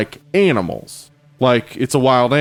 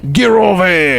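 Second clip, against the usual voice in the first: high, slow mock Aussie yell; Australian accent; 6 semitones higher, 4 dB louder, slower than usual. Co-host fights off wallaby, high drawn-out Australian accent. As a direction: drag out a put-on Australian shout. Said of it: "our Aussie listeners are like".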